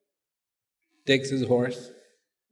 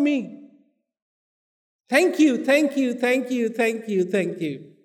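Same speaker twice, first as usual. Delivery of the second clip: grateful king; 12 semitones higher than usual. Acted. King kneeling in gratitude.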